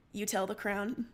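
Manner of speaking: ordinary delivery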